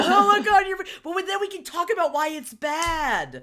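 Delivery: high, loud teasing laugh. Real, not acted. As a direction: laugh while teasing her. Teasing, laughing at her face.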